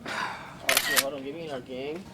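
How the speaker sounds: ordinary talk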